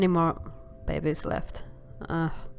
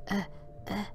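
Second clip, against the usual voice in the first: ordinary delivery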